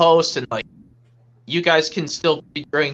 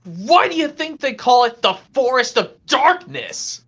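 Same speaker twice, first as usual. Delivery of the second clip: higher, exasperated character voice; cartoon character impression; 5 semitones higher than usual. Answering scared friend outside the forest, acted higher voice. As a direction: point out the obvious in character. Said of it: "now it's my turn".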